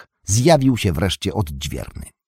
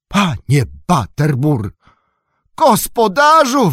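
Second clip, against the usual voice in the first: a slow, much higher and louder cry of surprise; astonished shout; 12 semitones higher, 7 dB louder than usual. Acted. Nob shouting for the landlord in astonishment. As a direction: call out to the innkeeper in shock. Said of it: "krzyknął ze zdumienia".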